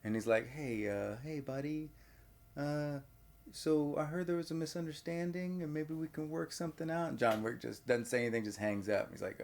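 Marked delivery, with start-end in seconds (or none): 0.5-7.1 tentative placating. Acted. Crime boss placating on the phone.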